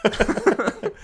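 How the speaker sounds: high-pitched British parody; mock British accent. Co-host repeating the meme, high mock British accent. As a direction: echo it in exaggerated British. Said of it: "in British accents".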